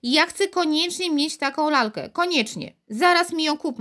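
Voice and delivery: put-on high, insistent child's cry; calling out insistently. A narrator's reading. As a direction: demand it like a spoiled little girl. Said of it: "wołała".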